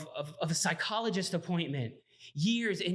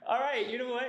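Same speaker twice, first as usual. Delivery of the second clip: laughing; 7 semitones higher than usual. Retold or imitated. Laughing about getting fired.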